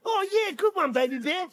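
lightly voiced impressed mother; approving Mama Bear. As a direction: praise the child's bright idea. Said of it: "And Mama Bear's like".

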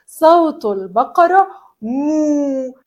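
ordinary talk

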